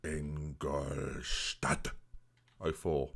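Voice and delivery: very slow, low, soft Ingolstadt in a put-on German accent; exaggerated German accent; mock German accent. Shown as a demonstration. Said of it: "what accent is that?".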